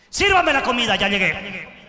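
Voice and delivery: loud, bossy order at the table; loud domineering demand; demanding husband. Retold or imitated. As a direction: bark it like a husband who wants his dinner now. Husband demanding food on arriving.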